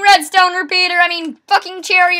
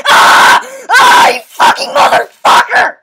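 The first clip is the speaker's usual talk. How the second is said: loud, high furious scream; raging scream; 7 semitones higher, 6 dB louder, slower than usual. Real, not acted. Gamer screaming at an opponent in fury. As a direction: rage at the other player.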